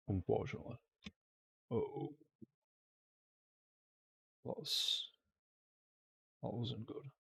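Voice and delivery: strained, worn-out voice; weak voice. Real, not acted. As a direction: voice cracking and fading. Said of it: "my voice is going".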